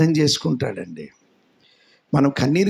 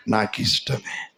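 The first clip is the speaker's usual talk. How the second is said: soft divine reply; 7 semitones lower, 8 dB quieter than usual. Acted. The Lord answers softly.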